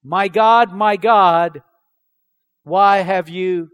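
a slow, loud preacher's shout of abandonment; loud anguished cry. Shown as a demonstration. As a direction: raise the voice and cry it out slowly, in anguish. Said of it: "but to shout with a loud voice".

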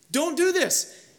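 high-pitched urgent objection, acted; imitating parents' protest; urgent parental plea. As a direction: voice worried parents trying to stop their son. Parents pleading with their son.

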